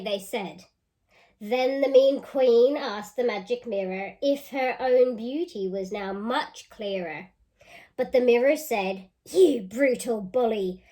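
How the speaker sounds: lively storybook reading; reading to children. Acted. Teacher reading a picture book aloud to children. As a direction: read it out with relish for the kids.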